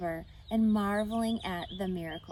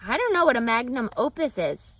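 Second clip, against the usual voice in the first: Wilbur puzzled; 4 semitones higher, 9 dB louder than usual. A narrator's reading.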